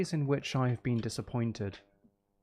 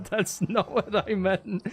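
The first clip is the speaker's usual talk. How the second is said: laughing; 6 semitones higher, slower than usual. Real, not acted. Laughs at own gaffe.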